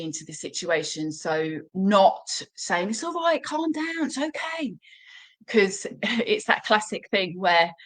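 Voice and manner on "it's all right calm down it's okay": much higher, softening as it goes; fussy, worried soothing that gives the game away; anxious high reassurance. Shown as a demonstration. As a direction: owner fussing over a barking dog in a high voice. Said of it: "I don't want my voice to go up an octave".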